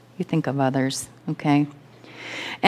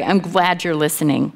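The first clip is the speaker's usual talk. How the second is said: usual speaking voice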